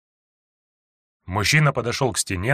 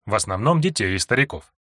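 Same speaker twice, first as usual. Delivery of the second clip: ordinary delivery, nothing marked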